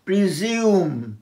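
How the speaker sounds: প্রিজিউম slowly as pri-zium, buzzing Z; Z sound. Shown as a demonstration. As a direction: stretch প্রিজিউম out with a clear Z. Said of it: "মানে জেড এর উচ্চারণ".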